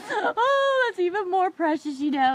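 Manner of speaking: high, loud mock-sobbing wail; acted crying. Daughter moved by God's sweet words, hammed-up tearful wail. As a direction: blubber it out high, easing down at the end. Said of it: "and I'm crying".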